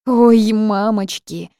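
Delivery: slow, loud, high cry of dismay. A narrator's reading. Heroine realizes her mistake, loud dismayed cry. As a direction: wail in horror at herself.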